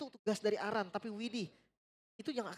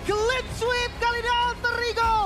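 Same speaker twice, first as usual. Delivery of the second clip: slow booming victory call, much higher; fighting game announcer; 12 semitones higher, 9 dB louder, slower than usual. Real, not acted. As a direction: roar the result over the crowd. Caster proclaiming clean sweep.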